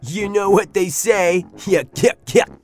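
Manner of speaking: joking with a yuck-yuck laugh; chuckling. Acted. Too Tall chuckling at his own joke. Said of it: "chuckling at his own joke".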